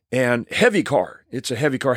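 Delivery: usual speaking voice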